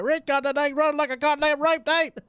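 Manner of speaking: mock the country fair guy with gusto; high, loud imitation of a country yokel at the fair; hillbilly voice. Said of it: "He said".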